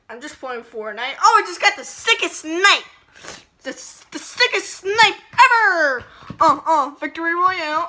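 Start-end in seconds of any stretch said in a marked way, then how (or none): 1.2-6.0 loud, gloating gamer brag; excited bragging. Acted. Bragging excitedly about a snipe. 6.4-7.9 celebrating. Acted. Celebrating a win.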